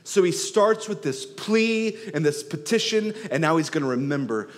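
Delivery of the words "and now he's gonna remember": normal talking voice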